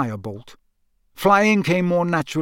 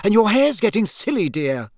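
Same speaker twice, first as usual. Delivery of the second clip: high, scolding mum voice; a mother fretting over her son's earring; fussing mother; 7 semitones higher, 4 dB louder than usual. Acted. Mrs Weasley voiced in story.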